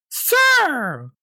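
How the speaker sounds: a high, shrill-pitched shout of "Sir!"; mock yell. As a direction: call out like an outraged lady across a parking lot. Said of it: "yelling at me".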